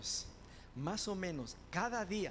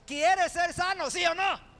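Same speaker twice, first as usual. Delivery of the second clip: Lord shouting; 11 semitones higher, 10 dB louder than usual. Retold or imitated. The Lord yelling at the sick man, high and loud. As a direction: shout the question to force an answer. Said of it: "y el Señor le grita".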